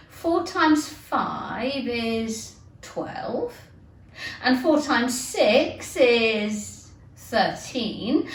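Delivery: very slow, careful times-table chant; slow recitation. Acted. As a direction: recite it slowly like a schoolgirl.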